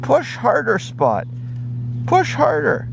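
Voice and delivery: high puppy voice, pushing with effort; high-voiced pup, mock strain; little dog straining. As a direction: squeak out the effort of shoving something heavy. Dog straining to push bone.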